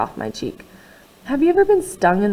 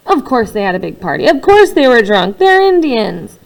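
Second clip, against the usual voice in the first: bitter outburst; 2 semitones higher, 9 dB louder than usual. Acted. Boy lashes out at father about the drunk party, loud and bitter.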